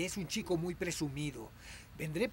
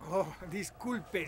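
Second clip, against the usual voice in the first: usual speaking voice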